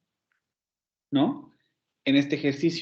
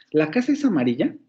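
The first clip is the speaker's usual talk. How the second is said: "¿La casa es amarilla?" with a rising tune at the end; as a question. Shown as a demonstration. Teacher shows the sentence as a question, rising tune. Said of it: "la puedo hacer como una pregunta".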